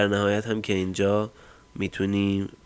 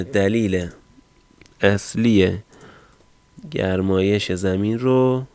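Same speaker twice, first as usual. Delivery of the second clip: slow dictation; 4 dB louder, slower than usual. Real, not acted. Typing a prompt while saying it.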